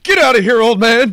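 high, loud mock yell at the old man; playful yell at a dad; mock protest. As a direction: shove him off with your voice. Kid shoving dad's hand away.

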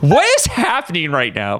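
acted confusion; genuinely confused. A narrator's reading. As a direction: ask it utterly baffled. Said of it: "genuinely confused".

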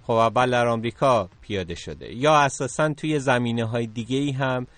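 ordinary talk

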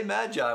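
Magi said as MADGE-eye; others' mispronunciation. Retold or imitated. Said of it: "a lot of people are like".

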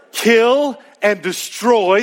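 normal talking voice

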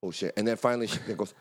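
ordinary delivery

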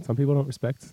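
slow, soft, heavy with meaning; mock deep sincerity. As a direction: play it like a solemn heart-to-heart. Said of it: "It's deep".

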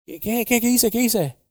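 acted alarmed confusion; startled reply. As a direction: imitate someone caught off guard. Retold startled reply to the shout.

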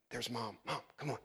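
mother summoned in a soft low hush; hushed call. Retold or imitated. Disciples hushing their mother over to Jesus, whispered. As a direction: quietly wave Mom over, keep it secret.

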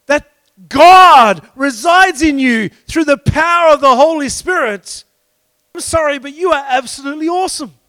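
high, emphatic preacher's delivery; impassioned preaching. Acted. Preacher telling the congregation God made them awesome, impassioned preaching. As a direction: preach with rising force, hammering key words.

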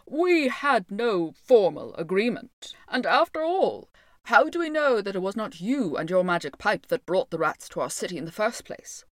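loud mayor disputing a debt; pompous Lord Mayor, dismissive. Acted. Lord Mayor refuses to pay the piper, pompous and dismissive. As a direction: talk down from the wall, loudly refusing.

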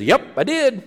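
high-pitched, put-on proud little reply; perky pygmy character. Pygmy answering traveler about the rhinoceros, perky put-on voice. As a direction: imitate a small, pleased hunter.

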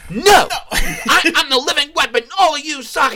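put-on high, loud childish shout; petulant living-weapon tantrum; bratty outburst. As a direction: yell it like a defiant teen. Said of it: "giving this good advice".

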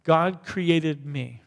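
each word slow and deliberate, "God created me" drawn out; measured congregational recitation; slow declaration. Shown as a demonstration. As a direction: spell it out word by word for the room to follow. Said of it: "For you to say that".

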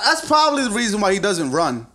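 ordinary talk, nothing marked

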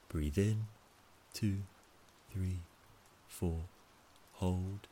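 calm, measured count with pauses; slow breath counting. Shown as a demonstration. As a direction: count each breath slowly and evenly. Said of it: "It goes like this".

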